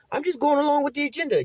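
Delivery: high mock British voice; squeaky comic British accent; British dude explaining himself. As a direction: imitate a nervous Brit making excuses. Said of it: "He's like some British dude".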